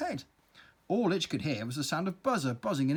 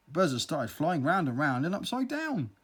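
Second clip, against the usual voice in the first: plain reading voice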